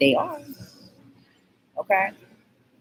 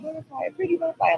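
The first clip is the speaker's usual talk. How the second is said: much higher, fast sing-song coo; cooing at a butterfly; 11 semitones higher, much faster than usual. Retold or imitated. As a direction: rattle it off high and sweet to the butterfly.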